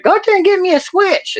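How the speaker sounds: high-pitched granny giving orders; hammed-up bossy granny; mean old grandma. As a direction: order it in a screechy old-lady voice. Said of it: "She'd be like".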